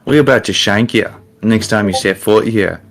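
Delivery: light London roadman voice; lightly put-on British slang accent. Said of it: "Yeah, Roadman style".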